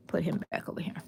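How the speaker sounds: soft unvoiced whisper. Real, not acted. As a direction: whisper it to yourself. Aside while fixing basket.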